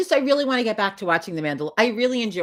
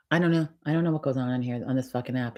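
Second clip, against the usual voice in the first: ordinary talk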